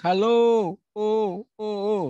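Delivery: high loud halo, then fading oh repeats; echo demo. Shown as a demonstration. Teacher demonstrating an echo, high fading shout. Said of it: "Jadi kalau orang teriak".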